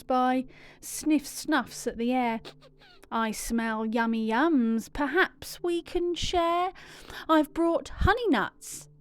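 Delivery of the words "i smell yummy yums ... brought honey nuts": plain reading voice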